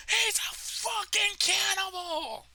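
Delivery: very high, slow, drawn-out shriek; mock horrified scream. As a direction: imitate someone screaming in horror. Retold horrified opponent screaming.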